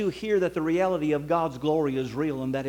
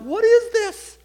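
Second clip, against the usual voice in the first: much higher and louder, breaking with tears; a boy crying out in wonder; tearful child's question; 8 dB louder, slower than usual. Retold or imitated. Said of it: "bawl like a baby".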